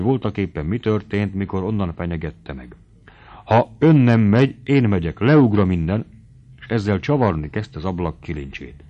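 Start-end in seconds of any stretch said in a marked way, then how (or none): 3.4-6.1 loud, high threat to leap from the window; desperate threat. Acted. Mari on the window ledge threatens Noszti, loud and high. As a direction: shout the threat from the sill.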